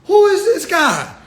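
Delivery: high, laughing disbelief; incredulous mockery. Comedian as onlooker baffled by the patriot, high laughing disbelief. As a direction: crack up in disbelief at the guy. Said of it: "I'll be like".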